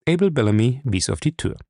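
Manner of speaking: plain reading voice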